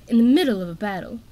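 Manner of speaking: snarling. A narrator's reading. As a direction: bite the words off angrily. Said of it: "Coral snarled".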